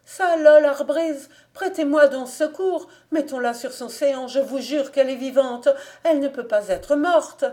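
high, loud, frantic cry for help; panicked pleading. A narrator's reading. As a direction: beg him to help, terrified. Panicked servant begs for help.

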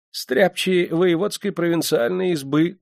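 high, slow, timid answer; cowardly. A narrator's reading. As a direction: answer meekly in a high, frightened voice. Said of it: "трусливо отозвались оба". (трусливо)

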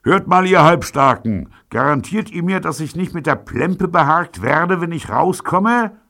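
high, loud hailing call; through a beer-mug megaphone. A narrator's reading. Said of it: "handhabte ihn wie eine Flüstertüte".

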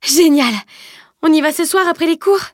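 aigu et fort, éclat de joie; elle exulte, la voix qui monte; cri de joie. Acted. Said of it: "laissa échapper un cri de joie". (joie éclatante, voix haute)

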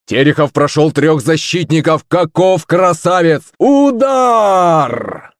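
building commentator shout to a high peak; football commentator. Acted. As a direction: scream the goal like live TV. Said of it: "кричал Кузя".

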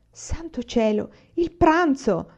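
a slow gasp, then a high cry; startled exclamation. A narrator's reading. Mother remembers the lunch, startled high exclamation. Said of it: "esclamò mamma".

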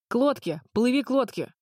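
urgent shout. A narrator's reading. Dich shouts to goose.

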